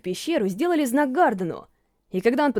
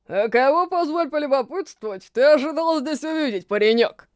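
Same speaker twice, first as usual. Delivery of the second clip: booming dwarf voice. A narrator's reading. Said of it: "пробасил он".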